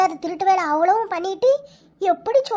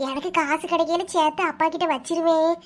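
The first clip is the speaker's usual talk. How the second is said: normal talking voice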